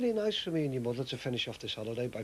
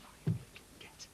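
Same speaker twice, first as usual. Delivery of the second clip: menacing whisper; 10 dB quieter than usual. Acted. Quiet menace before outburst.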